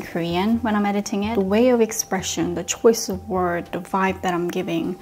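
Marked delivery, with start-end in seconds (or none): none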